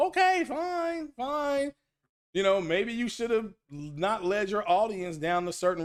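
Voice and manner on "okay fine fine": mock concession.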